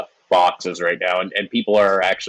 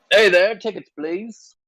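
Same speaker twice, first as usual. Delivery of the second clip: high-pitched little gnome voice; gnome ticket-taker; 11 semitones higher than usual. Acted. Gnome stops passengers at the gangway, high and chirpy. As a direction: pipe up high as a gnome official. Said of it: "one of the gnomes stops you".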